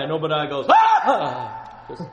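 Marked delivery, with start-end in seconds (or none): 0.7-1.6 loud, breathy mock gasp of shock; big hammed-up startled "ah". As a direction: gasp out loud as if caught off guard. Obadiah startled by Elijah.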